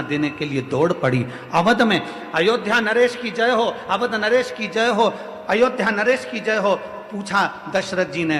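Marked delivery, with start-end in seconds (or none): 1.5-6.8 raised, ringing call to the king; higher-pitched repeated acclamation; urgent hailing. Acted. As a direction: hail the king as a messenger running in. Woman hails the king with news.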